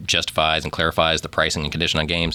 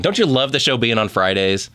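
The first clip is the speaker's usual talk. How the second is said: usual speaking voice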